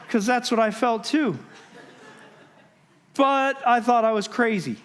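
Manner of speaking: ordinary talk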